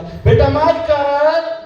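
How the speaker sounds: loud, high, long-drawn call; father calling out. Acted. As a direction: stretch the name out, calling from afar. Father calling his son over. (पिता की लंबी ऊँची पुकार)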